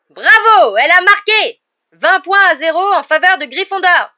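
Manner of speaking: very high, very loud goal call; jubilant shout. A narrator's reading. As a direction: celebrate the goal at full voice. Commentator celebrates a goal. (cri de but triomphal)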